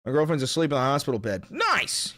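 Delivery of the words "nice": high sudden exclamation; excited nice. Real, not acted. Streamer cheering a viewer's message. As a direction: pop the word out. Reacting to chat.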